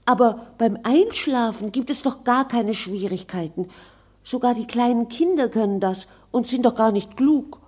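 put-on bright, high girl's voice, quick and pert; a spirited girl talking back; lively young girl. Virginia answers the ghost. (helles, lebhaftes junges Mädchen)

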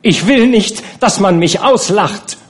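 high, loud indignant cry; angry outburst. A narrator's reading. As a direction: refuse to be laughed at, loudly. Nana's furious outburst continues. (hoch und laut, empört)

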